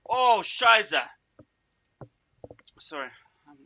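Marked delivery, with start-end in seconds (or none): 0.1-1.0 startled curse. Real, not acted. Exclaims after a loud cough.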